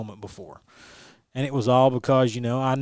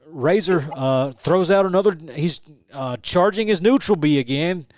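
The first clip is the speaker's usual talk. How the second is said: higher, louder trash-talk commentator voice; mocking commentator taunt; 4 semitones higher, 5 dB louder than usual. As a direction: jeer like a heckling commentator. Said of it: "trying to do the Razor gimmick".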